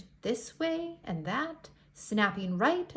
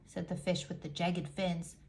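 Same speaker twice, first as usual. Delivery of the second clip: ordinary delivery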